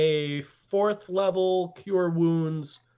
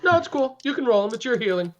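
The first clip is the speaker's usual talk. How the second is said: excited; 7 semitones higher, faster than usual. Real, not acted. Offering the dice roll.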